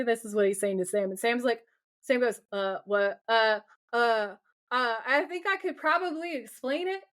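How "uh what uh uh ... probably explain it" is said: imitating a caught kid stammering; awkward. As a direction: fumble for an excuse with halting uhs. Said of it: "it's so awkward".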